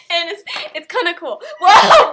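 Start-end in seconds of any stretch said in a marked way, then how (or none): 1.6-2.1 loud "whoa" that cracks. Real, not acted. Girl surprised mid-podcast sign-off, real voice crack. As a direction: let the voice break on a loud exclamation. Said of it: "voice crack".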